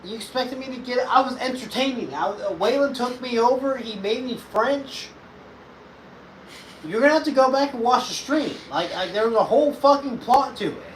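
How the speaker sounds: high-pitched, exasperated protest; worked-up, high defensive rant; indignant self-defence. Real, not acted. Defending himself in a squabble.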